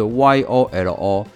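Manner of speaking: "Y O L O" said letter by letter, slowly; spelling it out. Shown as a demonstration. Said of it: "那yolo这个字怎么拼呢".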